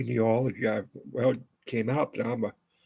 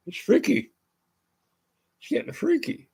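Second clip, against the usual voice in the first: high, eerie tease; spooky voice; 12 semitones higher than usual. Pretend. Teasing viewers about his freaky likeness in a spooky voice. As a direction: make it sound spooky.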